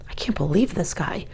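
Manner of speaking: unbelieving whisper to self; exasperated whispered aside. A narrator's reading. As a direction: mutter it under breath. Private thought, whispered aside.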